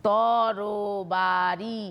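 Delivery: "তরবারি" stretched slowly across each syllable; very slow, drawn out. Shown as a demonstration. Teacher stretching the word so students hear each vowel. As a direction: drag out তরবারি so the vowels stand out. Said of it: "স্বরধ্বনি দেখো পিছনে".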